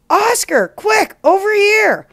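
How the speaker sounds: high, loud call from far away; urgent shout. A narrator's reading. Cloud calls Oscar from the sky, urgent high shout. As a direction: shout urgently to summon him. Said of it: "He shouts".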